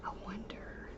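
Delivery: breathy whispered musing; whispering. Real, not acted. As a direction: whisper it to yourself. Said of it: "I'm whispering".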